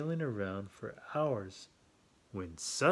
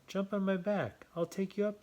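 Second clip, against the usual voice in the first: higher, inviting buzzard; coaxing buzzard; 9 semitones higher than usual. A narrator's reading. Buzzard tempts squirrel to ride, higher voice. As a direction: lure him up, voice pitched high.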